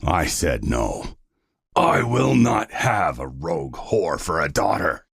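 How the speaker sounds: deep, slow, furious father's roar; very low, raging father voice; angry scream. A narrator's reading. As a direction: lay down the law in fury. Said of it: "he screams".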